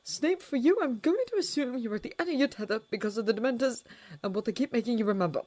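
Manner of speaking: shaken. A narrator's reading. Watson upset, shaking.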